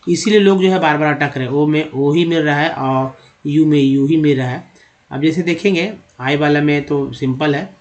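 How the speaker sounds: usual speaking voice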